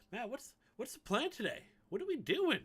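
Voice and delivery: squeaky high mock question; mock viewer voice. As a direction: imitate an eager viewer, pitched high. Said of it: "I'm sure you're asking".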